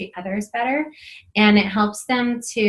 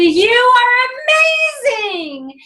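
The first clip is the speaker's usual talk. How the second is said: high, slow, loud exclaimed praise; love fight shout; 7 dB louder, much slower than usual. Retold or imitated. Mom shouting praise to kid at bedtime in a love fight. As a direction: belt out the compliment. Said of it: "we did a love fight".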